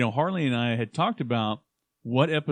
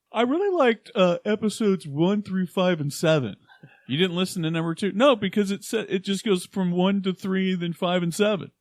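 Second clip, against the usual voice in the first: mock coworker voice; 6 semitones higher, 3 dB louder than usual. Mimicking coworker, high voice.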